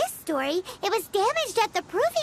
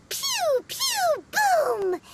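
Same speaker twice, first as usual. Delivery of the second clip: missile sound effects; 7 semitones higher, much slower than usual. Retold or imitated. Paimon imitates missiles firing.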